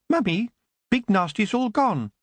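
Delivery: put-on high, bright small child's voice; little boy. Little boy telling his mother the monster is gone, childlike. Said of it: "a little boy took his mother's hand".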